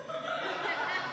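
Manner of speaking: real laugh breaking through; can't hold back a giggle; breathy giggle. Real, not acted. Laughs at own joke.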